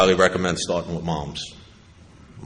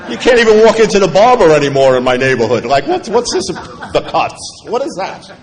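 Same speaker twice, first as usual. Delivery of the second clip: higher and louder, laughing through the gripes; laughing rant; 9 semitones higher, 9 dB louder than usual. Real, not acted. Speaker to AA crowd about doctors, laughing. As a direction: laugh at the absurd waits as you complain.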